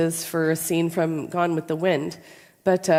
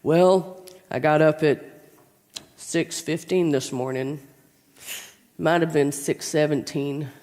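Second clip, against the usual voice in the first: Uncle John; 2 semitones lower than usual. Retold or imitated. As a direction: plod through every detail, imitating a tedious uncle. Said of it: "his story would have started like this".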